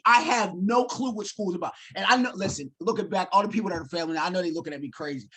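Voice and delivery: ordinary talk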